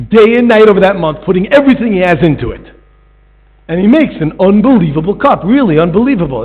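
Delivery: rising preacher's emphasis; building emphasis. Acted. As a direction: push it harder the second time. Repeated phrase, louder.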